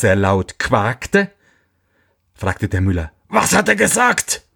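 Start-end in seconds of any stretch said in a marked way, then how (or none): 3.3-4.4 very high, urgent miller voice; eager question. A narrator's reading. Miller paid for the fifth prophecy, asking eagerly. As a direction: demand it high, bursting to know.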